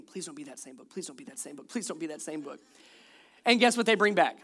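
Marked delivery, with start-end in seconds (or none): 0.0-2.6 soft, pleading inner prayer; silent pleading prayer. Retold or imitated. Dad praying the kids pick another book, soft pleading. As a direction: beg quietly under your breath. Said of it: "I'm, I'm in my mind praying".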